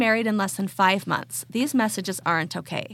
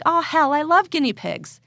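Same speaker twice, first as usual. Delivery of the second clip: delighted; 6 semitones higher, 4 dB louder than usual. Retold or imitated. Reading the poster's delight.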